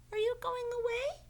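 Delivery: high, bright little turtle voice; Franklin's voice. Acted.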